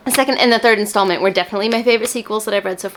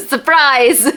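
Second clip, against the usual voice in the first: bright, louder "Surprise!" as a joke reveal; mock surprise; 5 semitones higher, 5 dB louder than usual. Telling viewers her obvious pick with mock surprise. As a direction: pretend it's a shock.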